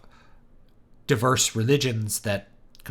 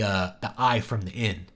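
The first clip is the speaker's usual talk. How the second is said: ordinary delivery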